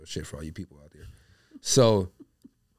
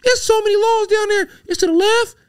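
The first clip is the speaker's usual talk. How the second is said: mom's voice; 8 dB louder than usual. Retold or imitated. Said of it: "She's like".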